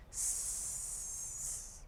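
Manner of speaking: long hiss; snake hiss. Shown as a demonstration. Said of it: "They say".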